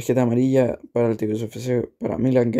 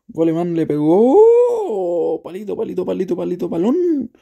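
rising excited play-by-play yell; excited shout; 6 semitones higher, 4 dB louder than usual. Acted. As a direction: shout it as the shot hits the post. Shot hits the post.

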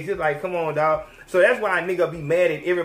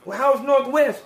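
higher, sing-song mock flattery; butter her up, pitched up; fawning paparazzo; 6 semitones higher than usual. Paparazzi cameraman fawning over Kim on the street, higher mock flattery.

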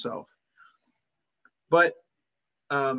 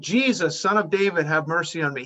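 acted yell; 3 semitones higher, 4 dB louder than usual. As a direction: cry out over a crowd for mercy. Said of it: "He yelled".

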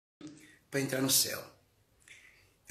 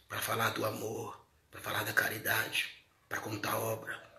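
hushed preaching; 3 semitones lower, 7 dB quieter than usual. Acted. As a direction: draw them in quietly. Sermon winding down softly.

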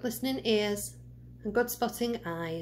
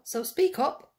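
speak up; 6 semitones higher, 4 dB louder than usual. A narrator's reading. Giantess half-deaf demanding.

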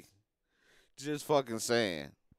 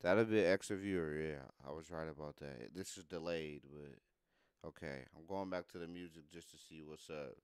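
muttering to himself; 9 semitones lower, 11 dB quieter than usual. Real, not acted.

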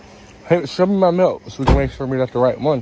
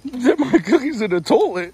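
much higher outraged repeat; incredulous echo; 10 semitones higher than usual. Real, not acted. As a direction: throw the insult back in disbelief.

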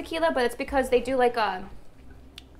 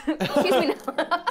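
laughing; 3 semitones higher than usual. Real, not acted. Laughing after swearing on camera.

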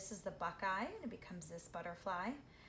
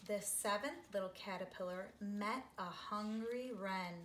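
gentle storybook read-aloud; lilting picture book voice; reading to children; 2 semitones higher than usual. A narrator's reading. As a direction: read it like story time for little kids.

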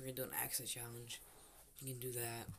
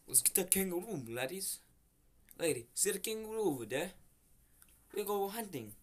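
higher, badly faked Australian accent; 6 semitones higher, 4 dB louder than usual. Player defends his attempt to his friend, mock Australian accent. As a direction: play at being an Australian, pitched up. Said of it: "That is an Australian accent".

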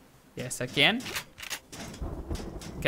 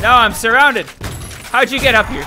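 higher, louder panicked cry; alarmed panic; 6 semitones higher, 6 dB louder than usual. Real, not acted. Gamer surrounded by enemies, loud high panicked yelp.

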